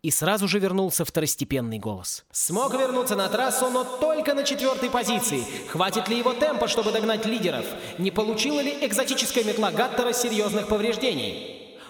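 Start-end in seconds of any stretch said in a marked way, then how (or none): 2.3-11.7 higher, lively sports commentary; race commentator. A narrator's reading. A race commentator shouting over the broadcast, lively play-by-play.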